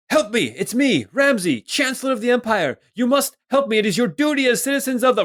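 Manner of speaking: high, loud desperate cries; shouting for help. Acted. Captive chancellor yelling to the passing party, high and loud. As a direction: yell for rescue like a captive official. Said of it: "you hear him start to shout out".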